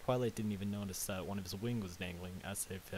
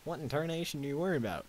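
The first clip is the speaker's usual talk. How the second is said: higher, lightly voiced southern accent; 6 semitones higher, 4 dB louder than usual. Applejack asks panicked Twilight the matter, southern accent. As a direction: exclaim in a mild southern accent. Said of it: "said in her southern accent".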